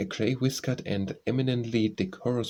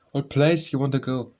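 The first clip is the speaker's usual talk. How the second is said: puzzled cabman, louder question; lightly voiced puzzled driver, a bit raised; perplexed; 3 semitones higher, 5 dB louder than usual. A narrator's reading. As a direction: ask with mild bewilderment. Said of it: "peered down perplexedly at me".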